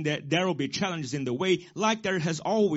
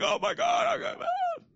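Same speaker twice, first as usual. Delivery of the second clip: very high, hammed-up crying child; mock childish wail. Pastor retells being kicked as child, mock wail. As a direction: imitate a small kid wailing after a kick. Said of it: "And I went crying".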